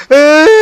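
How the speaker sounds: sudden high yelping cry; loud high-pitched mock scream; imitated scream. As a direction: mimic the kid's startled wail. Kid cries out over ball.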